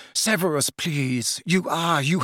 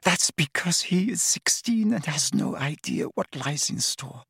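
crying whisper; 5 semitones higher, 4 dB quieter than usual. A narrator's reading. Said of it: "Narcissa began to cry in earnest".

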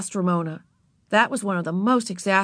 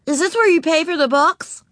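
put-on little girl voice; 11 semitones higher, 5 dB louder than usual. A narrator's reading. Narrator voicing Ramona to Beezus, high and childlike. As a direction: play four-year-old Ramona, proud. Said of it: "asked Ramona".

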